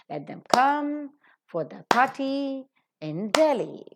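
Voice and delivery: stressed words "come", "party", "Delhi" on the beat; rhythmic stress demo. Shown as a demonstration. Teacher shows rhythm in a longer sentence. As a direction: keep an even rhythm through the line. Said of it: "that's how you maintain the rhythm".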